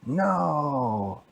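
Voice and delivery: storybook angry no; emphatic refusal. Acted. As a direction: reject it with a drawn-out cry. Baldi rejects the answer.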